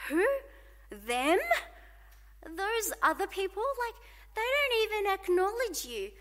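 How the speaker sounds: indignant prophet complaining to God; a lightly voiced indignant Habakkuk; voicing Habakkuk.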